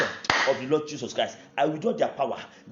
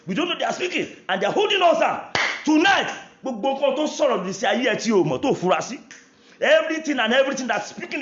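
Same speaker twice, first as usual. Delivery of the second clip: loud, high, fervent prayer over the congregation; full-force preaching prayer; 9 semitones higher, 6 dB louder than usual. Acted. Preacher praying loudly over the congregation, fervent and high. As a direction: pray over them with full power, pitched high and loud.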